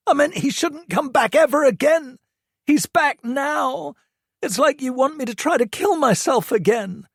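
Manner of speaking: high-pitched, loud furious rant; angry teen rant. A narrator's reading. Hurt son lists Toby's abuse to mum, furious and high. Josh's voice.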